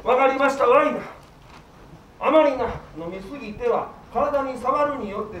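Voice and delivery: ordinary talk, nothing marked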